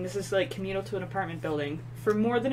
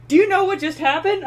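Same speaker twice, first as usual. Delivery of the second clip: excited question; 11 semitones higher, 9 dB louder than usual. Retold or imitated. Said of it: "he was just like".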